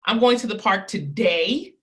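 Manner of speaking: going stressed, rising; I'm going to the park today, with the weight on going. Shown as a demonstration. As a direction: push the word going up. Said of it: "I'm gonna emphasize it three different times".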